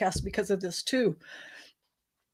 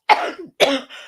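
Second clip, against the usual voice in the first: coughing fit; 4 semitones higher, 7 dB louder than usual. Real, not acted. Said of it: "are you okay?".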